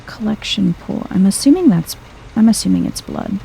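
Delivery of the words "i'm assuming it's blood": usual speaking voice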